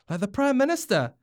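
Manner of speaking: high, loud stage whisper; too-loud whisper. Acted. Hine asking her dad, an over-loud whisper. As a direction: whisper far too loudly, excited. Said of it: "Hinakura asked loudly".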